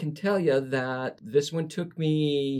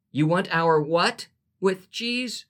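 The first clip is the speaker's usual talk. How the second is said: put-on higher, louder exasperated drive-thru girl; 5 semitones higher, 3 dB louder than usual. Drive-thru girl loses patience with the customer, exasperated. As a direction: let her patience snap, up and loud. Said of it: "Exasperation filled her voice".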